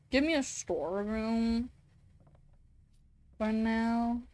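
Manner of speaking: normal talking voice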